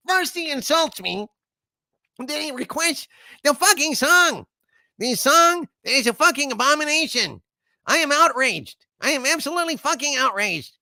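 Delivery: high-pitched outraged god voice, ranting; indignant high rant at an insulting request; vexed Harvey. Acted. Harvey fuming at a viewer's insulting request, high and outraged. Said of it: "is very vexed by that last super chat".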